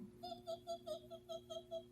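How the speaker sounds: very high tiny giggle; high little bat laugh; squeaky bat giggle. Acted. Funny little bat sounds.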